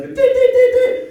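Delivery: high loud dinging timer; timer dings. Retold or imitated. Mimicking the game timer speeding up. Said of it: "the timer starts getting going".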